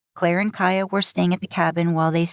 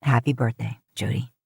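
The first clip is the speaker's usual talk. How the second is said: Sam's voice; 6 semitones lower than usual. Acted. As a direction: murmur a birthday wish in a low voice. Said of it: "Sam's hugs were still the best".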